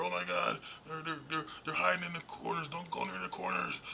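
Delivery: high, loud panicked babble, stumbling over they're; panicky guy. Retold or imitated. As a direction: act like a freaked-out horror-movie guy warning everyone. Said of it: "some guy comes out and goes".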